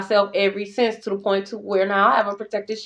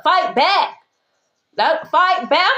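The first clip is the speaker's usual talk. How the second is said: higher-pitched imitated order to fight; mean command; 6 semitones higher, slower than usual. God ordering a hurt believer to fight, mock-mean command. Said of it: "you basically being mean to me".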